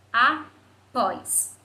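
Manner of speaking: "Após" broken into a, pós; "Após" pronounced syllable by syllable, loud and deliberate; split into syllables. Shown as a demonstration. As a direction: say "Após" as two separate beats with a pause between. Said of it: "De sílaba".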